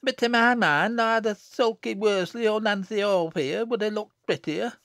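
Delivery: loud, high dialect voice full of spite; spiteful. A narrator's reading. Jennet belittling her sister's looks, spitefully. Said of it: "she observed spitefully".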